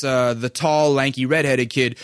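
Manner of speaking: normal talking voice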